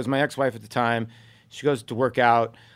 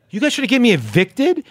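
higher and louder, stunned at the news; shocked disbelief; 8 semitones higher, 4 dB louder than usual. Retold or imitated. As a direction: sound floored that they reported you. Retold shock at the eviction attempt.

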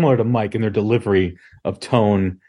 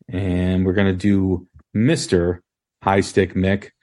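normal talking voice

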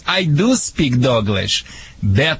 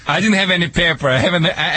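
ordinary talk

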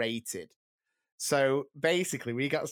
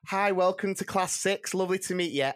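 higher, sweet welcome to a visitor; bright teacher greeting; 7 semitones higher than usual. Retold or imitated. Teacher greeting the inspector, higher and sweet.

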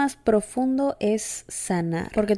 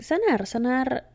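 usual speaking voice

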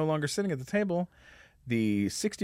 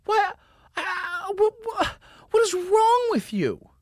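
very high, screechy mock scolding; exasperated woman. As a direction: sputter in hammed-up falsetto outrage. Lady scolds him, retold.

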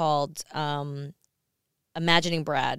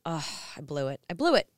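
retold dismay; 3 dB quieter than usual. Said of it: "I left there and I went".

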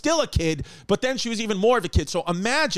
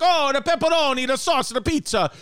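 high, hammed-up Italian accent; a light jokey Italian accent, pitched high; lightly done Italian accent mocking an Italian; 7 semitones higher than usual. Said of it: "mocking an Italian person".

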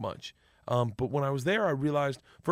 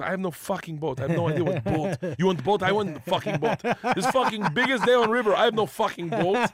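1 Igor ranting; 5 semitones higher, 4 dB louder than usual. Retold or imitated. Igor ranting about missing boat.

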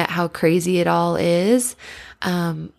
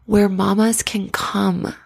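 usual speaking voice

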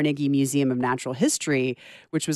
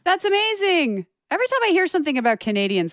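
high, gushing excitement; delighted; 13 semitones higher than usual. Real, not acted. Co-host reacting to the Canadian postcard news, delighted. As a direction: burst out thrilled, pitch way up, then racing on.

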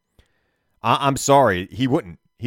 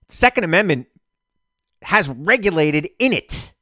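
heated rant; 6 semitones higher, 4 dB louder than usual. Real, not acted. Heated rant about gun rights.

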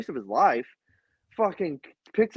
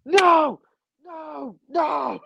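high, loud screams of no; screaming no; 11 semitones higher, 5 dB louder, much slower than usual. Retold or imitated. Fan screaming as rival takes the win. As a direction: scream it like the Office meme. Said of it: "I was just like".

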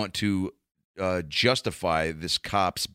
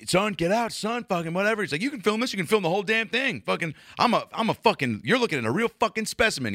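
high, cartoonish bully cop voice; mock a cocky roided-up officer; aggressive cop; 11 semitones higher than usual. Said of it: "and then just goes".

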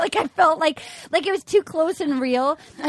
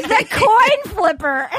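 laughing shout; 5 semitones higher, 4 dB louder, much slower than usual. Real, not acted.

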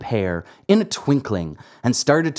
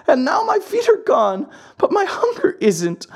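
high-pitched puppet pouring out his woes; wailing Pinocchio; 12 semitones higher than usual. A narrator's reading. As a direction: wail a jumbled childish complaint in a high voice. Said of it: "began to scream and cry".

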